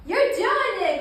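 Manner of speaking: high, loud delighted cheer; excited praise. Real, not acted. Mom praising her child's down dog, excited cheer.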